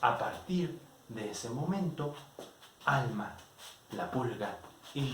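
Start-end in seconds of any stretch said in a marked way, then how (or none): none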